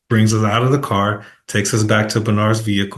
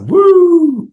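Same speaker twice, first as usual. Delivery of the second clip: "Woo!" as a high, loud siren whoop; imitated police siren; 7 dB louder than usual. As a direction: whoop like a squad car lighting you up. Police lights them up.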